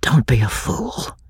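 urgent whispered warning. A narrator's reading. Ravenel warns his wife at her side, whispering. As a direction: hiss it under your breath. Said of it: "whispered Ravenel at her side".

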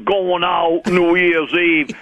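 slow, dull repeat of the question; dopey echo. Retold or imitated. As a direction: echo it back like a dim lug.

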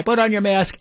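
high-pitched mock yell; losing it. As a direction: imitate someone losing it, no real volume. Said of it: "she then completely loses it".

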